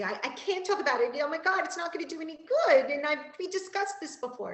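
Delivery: high, loud, rushed panicky protest; imitating a frantic kid who won't talk; upset child. Imitating an upset child refusing help, loud and panicky.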